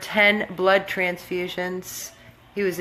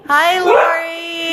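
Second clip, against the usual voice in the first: talking to a dog; 10 semitones higher, 8 dB louder, slower than usual. Real, not acted. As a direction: coo to the dog about Lori. Baby talk to the dog.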